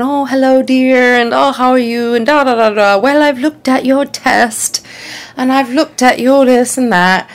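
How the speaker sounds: put-on kindly old Australian doctor voice; charming old Australian doctor; Australian accent. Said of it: "this really great accent".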